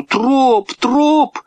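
high, drawn name calls; calling out. A narrator's reading. Boy calling his dog from the water, high calls. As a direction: call the dog twice, pitch climbing.

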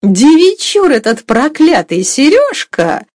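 high, loud, drawn-out grumbling cook; gossiping cook. A narrator's reading. Cook trailing after her mistress grumbles about Sergei, scandalised gossip.

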